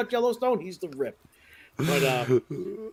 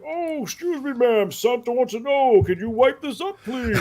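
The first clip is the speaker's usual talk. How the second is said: higher, sugary put-on Santa politeness; polite Santa voice; 6 semitones higher than usual. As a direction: Santa being overly polite through gritted teeth. Santa politely asking the mom.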